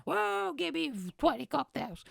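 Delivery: mocking freshers squealing about getting drunk; mocking drunk students. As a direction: squeal like a hyped eighteen-year-old. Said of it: "and they're like".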